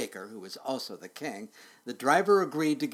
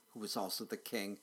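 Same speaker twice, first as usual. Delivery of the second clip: soft and sorrowful; sad, quiet; 4 semitones lower, 8 dB quieter than usual. A narrator's reading. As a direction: say it with a tear in your eye. Baker with a tear.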